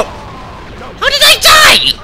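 very high, loud, drawn-out yell; outraged shout. Real, not acted. Gamer just killed in the game, outraged shout. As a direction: shriek it in disbelief.

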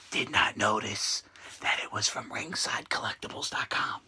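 low, soft secretive voice; hushed voice. Real, not acted. Confessing a secret purchase, low and hushed. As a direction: keep your voice down, confiding.

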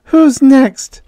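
high, loud demand. Acted. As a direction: demand an answer eagerly. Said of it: "she demanded".

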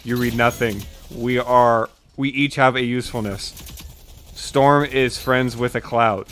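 ordinary talk, nothing marked

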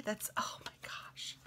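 delighted hushed whisper; whisper, thrilled by the find. Real, not acted. Whispering delight at friend's little T. Finding a T in the mail.

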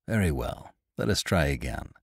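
lightly put-on nonchalance; forced unconcern. A narrator's reading. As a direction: feign mild indifference, voice dropped. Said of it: "replied with a forced unconcern".

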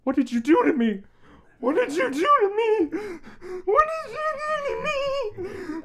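hammed-up despairing cry; mock anguish. Mock distress rising each repeat.

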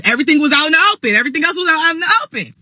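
fast, high-pitched gabble; rushed insistence. Real, not acted. Bride catching viewers up on the secret, fast high insistence. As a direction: rattle it off high and quick. Hurried emphatic repeat to viewers.

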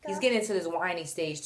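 usual speaking voice

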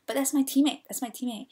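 retold indignant protest; protesting player. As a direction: imitate a girl arguing back insistently. Imitating girl arguing with referee.

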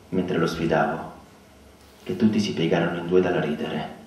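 usual speaking voice

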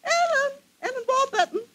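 delighted calling out. Acted. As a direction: call her name across the street, thrilled to recognize her. Recognizes old friend, calling out.

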